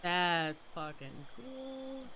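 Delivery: ordinary talk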